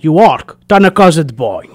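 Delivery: put-on loud Portuguese-accented grandma; grandma's Portuguese accent. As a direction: imitate a Portuguese grandma's broken English. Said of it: "And she's like".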